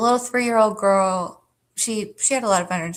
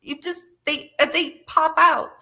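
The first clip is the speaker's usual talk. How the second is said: high giggling through the words; giggly and high, cracking up; laughing; 10 semitones higher than usual. Real, not acted. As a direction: laugh while you explain.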